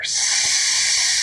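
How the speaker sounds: loud hissing sss; snake hiss. Acted. Mystery creature hissing ahead, loud snake hiss.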